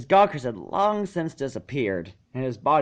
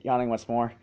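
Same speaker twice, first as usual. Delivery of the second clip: usual speaking voice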